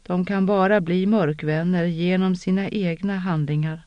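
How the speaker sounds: ordinary delivery, nothing marked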